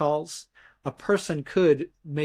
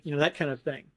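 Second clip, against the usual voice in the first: usual speaking voice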